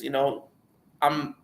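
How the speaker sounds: ordinary talk, nothing marked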